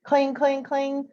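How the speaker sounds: high, ringing clang, clang, clang; playful bell sound effect; fire bell clang. A narrator's reading.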